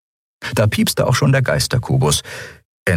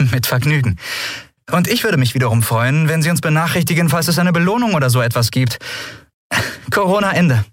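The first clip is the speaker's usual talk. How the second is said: higher-pitched, quick captain's reply; breezy Andriel signing off; 8 semitones higher, faster than usual. Acted. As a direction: rattle off the request in a lifted voice. Andriel signs off cheekily. (hohe, flotte Antwort per Funk)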